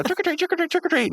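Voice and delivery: very high, rapid chipmunk chant; speeding-up tape. Retold or imitated. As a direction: imitate a cassette gone haywire, squeaky fast. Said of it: "it starts speeding up".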